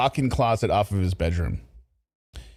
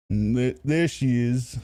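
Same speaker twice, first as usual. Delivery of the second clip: mocking Lorne; much slower than usual. Echoing Lorne's clip mockingly.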